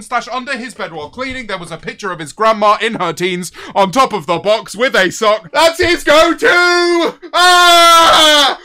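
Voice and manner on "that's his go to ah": high, loud, very slow yell then shriek; disgusted shout. Real, not acted. Streamer horrified by a gross dono, shouting.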